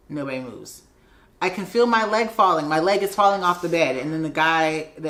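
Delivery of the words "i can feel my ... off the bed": louder, urgent plea to be heard; insistent retold complaint. Patient warning unmoved staff about her leg, loudly insistent. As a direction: insist loudly, as if nobody is listening. Repeated, ignored report grows insistent.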